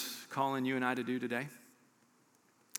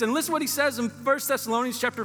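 higher, driving preacher voice building up; preaching at full force; 8 semitones higher, 4 dB louder than usual. Acted. Preacher rallying the church through Paul's hardships, full force. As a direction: preach it, climbing with fire.

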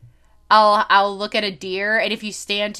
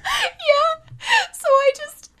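laughing it out; much slower than usual. Real, not acted. Cracking up after a laugh.

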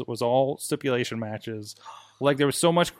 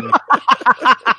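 loud laugh; 11 semitones higher, 11 dB louder than usual. Real, not acted. Laughs at story.

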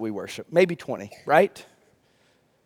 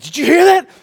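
higher, louder startled whisper-free alarm; imitating panicked wife; 8 semitones higher, 7 dB louder than usual. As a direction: mimic the wife jolted awake and scared. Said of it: "She woke me up in a panic".

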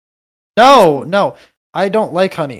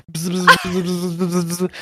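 buzzing; 3 semitones higher than usual. Acted. Imitating swarm buzzing.

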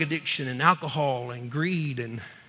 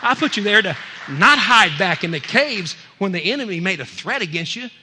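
stern rebuke; 4 semitones higher, 9 dB louder than usual. Acted. God's acted rebuke builds.

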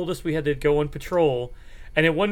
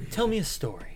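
borrowing a friend's catchphrase; quoting Dan; 4 semitones higher, 7 dB quieter than usual. Retold or imitated. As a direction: say it like a favorite catchphrase. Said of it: "borrowing a Danism".

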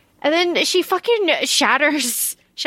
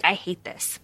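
understated distaste; 5 semitones lower, 8 dB quieter than usual. Retold or imitated. Retelling her reaction to audiobook.

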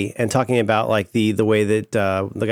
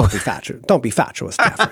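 put-on Mid-Atlantic accent. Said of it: "she is doing it".

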